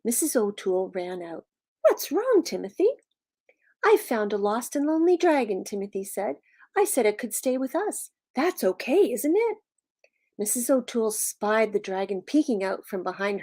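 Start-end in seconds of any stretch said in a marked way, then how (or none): 1.8-2.9 high-pitched worried question, dropping on the name; alarmed mother. Acted. Alarmed mother answers her son. 3.9-5.6 lightly voiced boy, pitched higher; earnest Timothy. Timothy explains to his mother. 6.8-9.6 put-on higher boy voice, lifting sharply at the end; hopeful Timothy. Timothy asks his mother.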